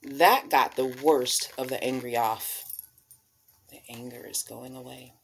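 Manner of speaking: expressive picture-book storytelling voice; reading to children. Acted. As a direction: read it like storytime on the rug.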